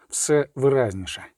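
ordinary delivery, nothing marked

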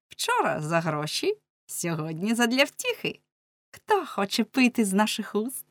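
lively, swooping, high-rising festive voice; merry girl of pleasure. Acted. Merry girl rallying her friends to love, exuberant and lilting. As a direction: toast love with lingering, lilting relish.

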